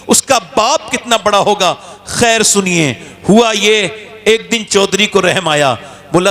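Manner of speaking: ordinary talk, nothing marked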